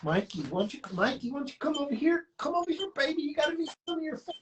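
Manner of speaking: pet-talk voice. Real, not acted. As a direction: coax the cat in a high cooing voice. Calling her cat over.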